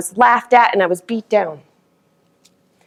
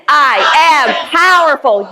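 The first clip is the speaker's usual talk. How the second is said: slow, high, belted affirmation; shouted declaration; 6 semitones higher, slower than usual. Shown as a demonstration. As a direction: belt it out like a rally cry.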